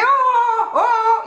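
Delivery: high-pitched "oh oh" to get attention; calling out. Retold or imitated. Woman calling up to noisy man on balcony, high calling-out. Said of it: "je, je, je lui disais".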